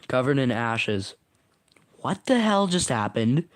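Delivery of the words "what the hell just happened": higher, dazed question; startled and woozy, voice lifted; lightheaded question. A narrator's reading. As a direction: ask it as if your head is spinning. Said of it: "Stinky lightheadedly asks".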